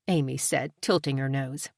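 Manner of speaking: usual speaking voice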